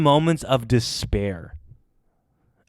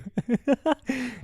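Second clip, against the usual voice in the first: high cracked giggle; 11 semitones higher, 6 dB quieter than usual. Real, not acted. Voice cracked, laughs at it.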